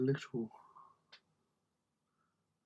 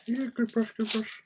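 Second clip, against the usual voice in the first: much higher, a little louder; squeaky figure voice; 11 semitones higher, 3 dB louder than usual. Pretend. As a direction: chirp "good brush" like a little figure. Playful repeat in a put-on voice.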